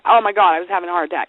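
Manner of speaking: ordinary talk, nothing marked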